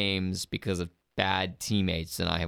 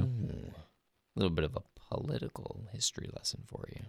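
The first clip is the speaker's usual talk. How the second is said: soft, sultry, fast murmur; sensual voice; 8 dB quieter than usual. Pretend. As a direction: say it seductively, softly. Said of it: "such a sensual voice".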